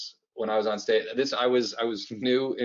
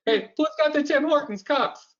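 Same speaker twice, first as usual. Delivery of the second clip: much higher, louder shouted survivor voice; apocalypse survivor; 14 semitones higher, 4 dB louder than usual. Retold or imitated. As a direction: yell it like a desperate scavenger. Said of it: "Those Tim".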